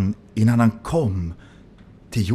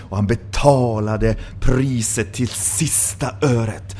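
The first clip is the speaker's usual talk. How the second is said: ordinary delivery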